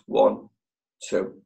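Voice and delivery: slow, falling count of two; counting the pause. Shown as a demonstration. Coach showing the two-second pause before replying, slow count. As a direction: count it out, leaving space. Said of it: "count two seconds".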